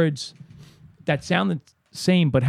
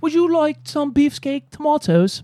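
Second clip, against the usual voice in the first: high, slow, put-on British clerk asking "sir"; British shop clerk; 12 semitones higher than usual. Garden shop clerk offering tomatoes, mock British. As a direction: ham up a posh English seller. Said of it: "they always say".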